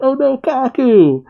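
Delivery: high, slow mock wail; dismayed cry. Agents seeing their colleague hit, high dismayed cry. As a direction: cry out the name high and drawn-out. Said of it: "C P agents cry out".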